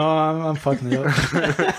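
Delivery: ordinary delivery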